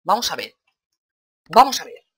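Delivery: emphatic, building to a high shout; loud challenge. Real, not acted. Player squaring up to an enemy, loud and exasperated.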